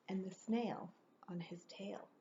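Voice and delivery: soft hushed whisper. A narrator's reading. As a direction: breathe it to the listener. Hushed storytelling aside.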